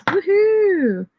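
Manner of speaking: high, bright cheer; excited woohoo. Real, not acted. Cheering her sprint result. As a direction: whoop with real joy.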